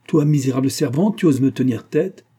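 loud, high furious scolding; furious rebuke. A narrator's reading. Mistress raging at the defiant old nanny, furiously. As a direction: lash out at the servant. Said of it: "avec un geste de fureur".

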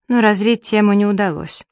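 usual speaking voice

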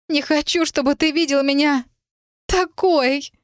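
high, tearful-sounding plaint; wretched, high-voiced distress; miserable plea. A narrator's reading. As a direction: plead in shame, pitched high. Miserable, ashamed of being seen.

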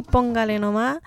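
usual speaking voice

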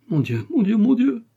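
desperate plea to God, pitched high; panicked pleading. Acted. Panicked queen, then she prays. (supplication affolée, voix aiguë)

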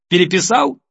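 строгий вопрос, выше и громче; строго спросить у сына. A narrator's reading. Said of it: "строго посмотрела на сына". (мать строго проверяет сына)

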